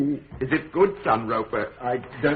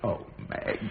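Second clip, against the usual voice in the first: whispered sigh; 4 semitones lower, 8 dB quieter than usual. Acted. Gentle reply to daughter.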